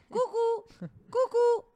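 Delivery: high playful cuckoo call; sing-song cuckoo, up high. Pretend. Playful cuckoo call while laughing.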